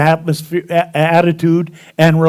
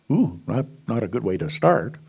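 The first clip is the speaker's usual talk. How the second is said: ordinary talk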